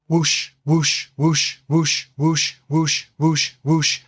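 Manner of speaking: mouth-made whooshing rockets in a quick run; rocket whoosh sounds. Retold or imitated. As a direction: mimic rockets ripping off the wings. Narrator imitates rockets firing.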